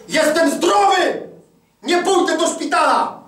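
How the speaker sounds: high, loud, slow declaration; stubborn husband shouting at wife; defiant shout. Retold or imitated. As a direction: shout it like a vow. Shouting his faith at wife.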